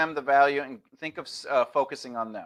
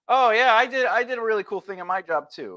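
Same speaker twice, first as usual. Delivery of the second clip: mock one-upping; 7 semitones higher than usual. Shown as a demonstration. Mock upping reply example.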